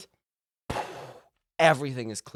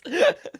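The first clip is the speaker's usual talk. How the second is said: high burst of laughter; laughing; 14 semitones higher, 6 dB louder than usual. Real, not acted. Storyteller cracking up after asking for applause, laughing. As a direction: crack up helplessly.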